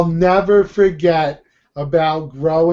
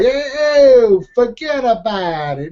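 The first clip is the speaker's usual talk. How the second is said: exaggerated Italian-American accent; 6 semitones higher than usual. Mocking Italian movies to co-host, exaggerated Italian-American accent. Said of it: "when you see these Italian movies".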